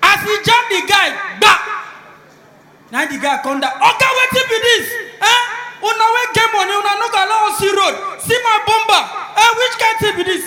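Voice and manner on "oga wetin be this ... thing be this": loud, high Pidgin rant; angry shout. Acted. Poor driver screams at rich man over crashed bumper.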